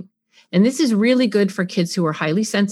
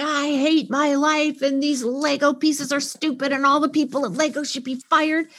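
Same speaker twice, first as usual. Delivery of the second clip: put-on upset child; 5 semitones higher than usual. Child at the broken Lego tower, grumbling. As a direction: grumble like a fed-up kid. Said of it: "Your child's gonna say".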